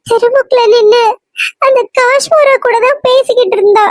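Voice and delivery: a frightened friend calls Sandy, sobbing down the phone; tearful phone call. Acted. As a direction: choke out the news through tears. Friend calls, sister is missing.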